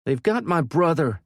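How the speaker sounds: high, loud desperate yell. A narrator's reading. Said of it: "Maddie yelled".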